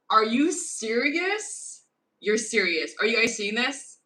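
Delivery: loud, high, stunned disbelief; flipping out. Real, not acted. Vlogger shocked by what she sees on camera. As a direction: shout it out in real amazement. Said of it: "I'm flipping out".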